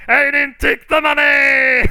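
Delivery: very high, loud cartoonish yell; frantic protest shout. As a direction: shriek your innocence while riding past. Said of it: "He's like".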